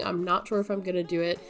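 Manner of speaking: usual speaking voice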